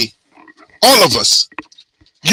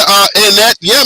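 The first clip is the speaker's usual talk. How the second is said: ordinary delivery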